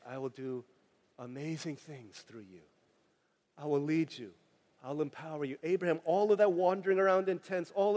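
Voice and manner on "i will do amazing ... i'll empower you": soft voice of God. Retold or imitated. God's promise voiced softly.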